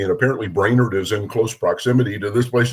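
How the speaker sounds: ordinary talk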